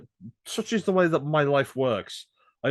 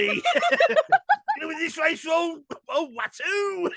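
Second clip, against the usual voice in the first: slow, high, laughing Cockney Watto; London accent Watto; much slower than usual. Retold or imitated. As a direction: drag it out, cracking up in character. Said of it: "Watu with a London accent".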